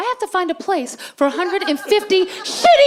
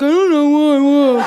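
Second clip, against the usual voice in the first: dopey husband impression, louder; 6 dB louder than usual. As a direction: play the clueless husband. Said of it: "same husband voice, and this is it".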